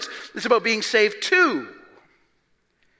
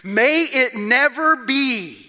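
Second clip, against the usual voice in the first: "May it never be" drawn out and louder; slow emphatic; 3 semitones higher, 4 dB louder, slower than usual. Acted. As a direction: insist on it, word by word. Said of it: "very emphatic".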